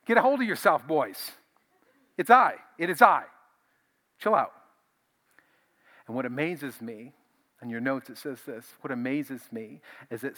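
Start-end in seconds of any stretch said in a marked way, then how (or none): 0.0-4.5 high, loud, hearty call of reassurance; Jesus calming them. Acted. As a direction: loudly settle down frightened men. Jesus calms panicking disciples.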